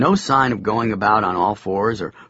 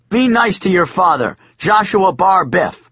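put-on yelling child's full name; 8 semitones higher, 5 dB louder than usual. A narrator's reading. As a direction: call him out like a stern father. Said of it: "used my full name like this".

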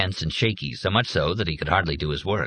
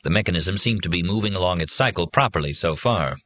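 ordinary delivery

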